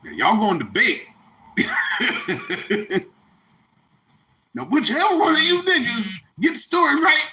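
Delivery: high-pitched, hammed-up preacher voice; high mock preacher voice. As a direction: play a flamboyant preacher-like Jesus for laughs. Comedic Jesus-like figure addresses disciples.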